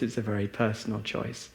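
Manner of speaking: ordinary talk, nothing marked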